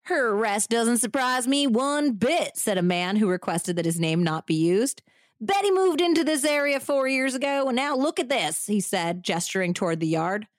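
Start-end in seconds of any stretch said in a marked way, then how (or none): 0.1-2.6 hammy Texan man; bad Southern accent. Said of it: "bad Southern accent acting". 5.4-8.6 hammy Texan man; bad Southern accent. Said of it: "bad Southern accent acting".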